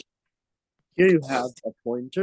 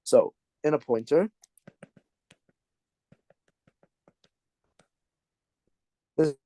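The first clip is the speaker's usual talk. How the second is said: breaking up over a bad connection; choppy mic dropouts; scrambled voice; 3 semitones higher than usual. Real, not acted. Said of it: "your mic is cutting off".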